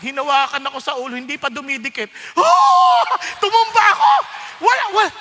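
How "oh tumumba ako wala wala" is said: sudden high loud cry; reliving being knocked down in a loud, high voice; exclaiming. Retold or imitated. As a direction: shout it as if falling backwards. Falling under the touch.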